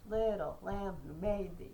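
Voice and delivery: Little lamb who made thee, stressed syllables punched and slow; trochaic stress. Shown as a demonstration. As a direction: beat out the trochees, strong then weak. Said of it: "Sometimes he doesn't".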